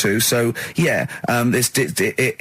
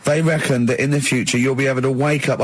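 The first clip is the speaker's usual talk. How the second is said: ordinary talk, nothing marked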